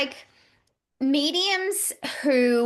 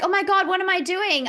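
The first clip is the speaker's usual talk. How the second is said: higher-pitched, mildly flustered retelling; retold alarm; 8 semitones higher than usual. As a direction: imitate your own mild panic. Retold panic at lingering feeling.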